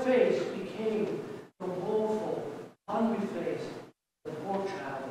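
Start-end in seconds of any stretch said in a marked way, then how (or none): none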